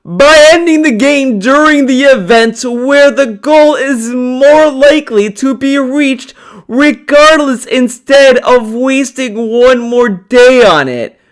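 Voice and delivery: high, put-on read-out voice. Streamer reading a baffling comment aloud, high put-on voice. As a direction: recite it high, in one long run.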